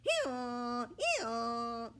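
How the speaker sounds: loud high hee-haw bray; donkey bray. A narrator's reading. Donkey Romeo brays laughing at the pig. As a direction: bray hard, laughing at him. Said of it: "hee-hawing".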